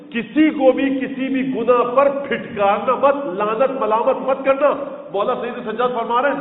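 rising majlis oratory, voice lifted high; full-force preaching. Acted. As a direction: preach it out to the crowd. Preacher rebukes society, rising.

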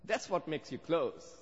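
ordinary talk

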